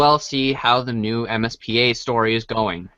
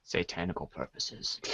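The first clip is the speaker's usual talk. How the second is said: mock-ominous mutter; 10 semitones lower, 17 dB quieter than usual. Deep mock-ominous aside.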